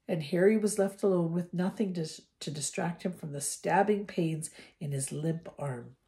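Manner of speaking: ordinary delivery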